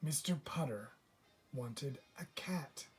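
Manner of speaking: plain reading voice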